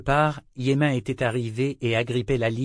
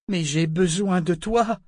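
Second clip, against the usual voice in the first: higher-pitched, impulsive plea; sudden exclamation; 6 semitones higher, 4 dB louder than usual. A narrator's reading. Althea blurts her need to the ship, impulsive exclamation. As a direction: blurt out a plea before thinking. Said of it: "L'exclamation lui échappa".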